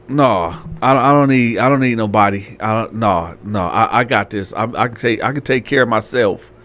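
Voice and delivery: low-pitched, stumbling brush-off; subdued refusal. Acted. Girl refusing landlord's offer of help, subdued.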